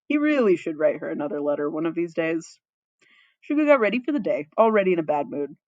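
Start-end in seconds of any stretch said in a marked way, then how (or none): none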